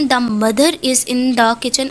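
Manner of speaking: mother linked to is with a sounded R, said slowly; slow demo: mother-R-is run together; linking R. Shown as a demonstration. Teacher slowly models the linking R in mother is. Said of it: "R sound क्यों pronounce करें".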